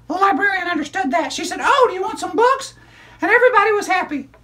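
put-on high, bright child puppet storyteller; squeaky kid character excitedly telling a story; high-pitched puppet girl narrating. Puppet continues.

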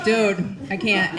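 ordinary delivery

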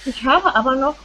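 ordinary talk

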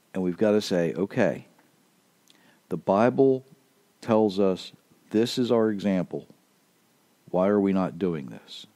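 ordinary talk, nothing marked

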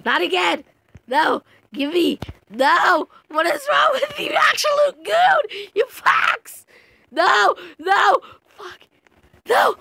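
wailing what is wrong with you; anguished shouting. Real, not acted. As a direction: scream in real outrage at the player. Game rage, shouting.

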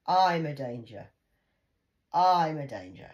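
mother echoing the accusation; indignant echo. Real, not acted. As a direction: repeat it incredulously.

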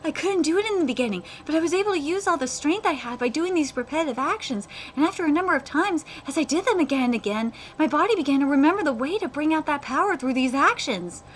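animated story reading; manga read-aloud. Acted. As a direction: read dialogue aloud at a lively clip. Reads manga dialogue aloud.

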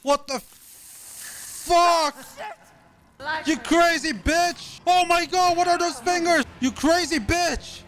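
high, frantic shouting; panicked yelling. Real, not acted. Gamer screaming at the crazed witch mid-fight. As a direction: yell at the boss in panic.

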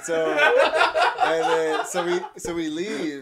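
laughing, pitched well up and loud; squeaky laughing recap; laughing high. Real, not acted.

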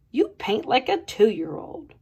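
ordinary delivery

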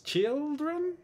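put-on children stretched long and very high; emphasized word. Shown as a demonstration. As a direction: hold children on a high, rising note. Teacher stresses the plural form.

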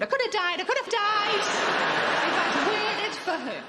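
slow, stretched and laughing; laughing exaggeration. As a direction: drag it out in mock outrage.

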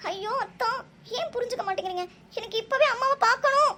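high desperate plea building to a loud wail; distraught pleading. Acted. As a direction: burst out, demanding mum right now. Distraught girl demands to see mother.

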